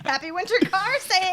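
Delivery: fast, high, peppy sales pitch; ad announcer voice. Pretend. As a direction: blare it like a local TV ad. Mock car dealer ad.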